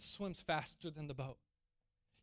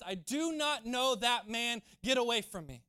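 firm, raised-voice rebuff; emphatic denial; 3 semitones higher, 5 dB louder than usual. Acted. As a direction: stress each word to shut the questioner down. Third denial, acted emphatic Peter.

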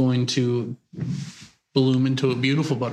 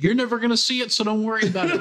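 higher, blunt deadpan reply; blunt nurse; 8 semitones higher than usual. Retold or imitated. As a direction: say it cheerfully but bluntly. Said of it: "you just wanna be like".

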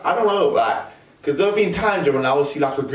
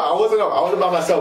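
ordinary talk, nothing marked

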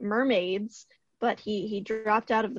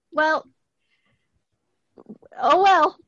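flustered; 5 semitones higher, 7 dB louder than usual. Real, not acted. Awkward apology after the reveal.

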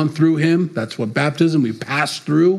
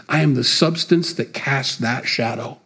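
usual speaking voice

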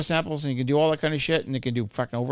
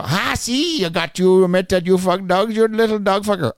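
gloating taunt; 5 semitones higher, 5 dB louder than usual. Retold or imitated. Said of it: "I got him so fucking mad".

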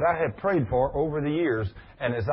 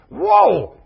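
loud, high burst of amazement; amazed whoa; 12 semitones higher, 10 dB louder than usual. Retold or imitated. Preacher recalling the woman's healing, astonished. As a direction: blurt it out in wonder.

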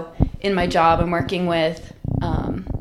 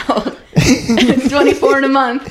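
loud, higher-pitched words through laughter; laughing; 6 semitones higher, 7 dB louder, slower than usual. Real, not acted. Telling podcast listeners their age, laughing. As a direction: laugh as you say it, louder.

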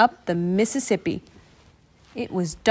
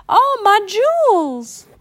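much higher, louder, slow cry; scream; 12 semitones higher, 6 dB louder, slower than usual. A narrator's reading. Rich lady screaming as her handbag flies overboard. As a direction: shriek as the bag flies off. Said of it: "she screamed".

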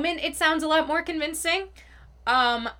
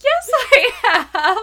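higher, slow, laughing through it; nervous laugh; 14 semitones higher, 6 dB louder, much slower than usual. Retold or imitated. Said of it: "tried to fake it".

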